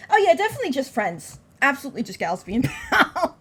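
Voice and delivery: loud, high, gleefully sarcastic; mock-agree loudly, breaking into a laugh at the end; amused sarcasm. Real, not acted. Player joking about the love letter, laughing sarcasm.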